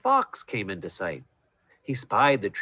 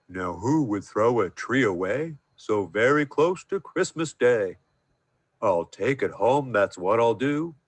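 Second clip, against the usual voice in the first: bear voice. A narrator's reading. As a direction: talk like a big bear. Bear character voice.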